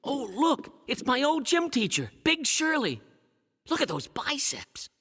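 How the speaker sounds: high, awed excitement; thrilled visitor back at the old school; nostalgic delight. Acted. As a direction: marvel at each familiar sight. Revisits his old school, delighted.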